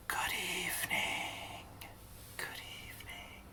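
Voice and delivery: very soft, slow whisper; quiet whisper. Shown as a demonstration. Teacher shows kids a quiet good evening, whispered. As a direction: whisper it, drawn out. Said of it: "when we're saying it quietly".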